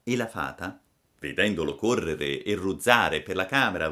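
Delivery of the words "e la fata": ordinary delivery, nothing marked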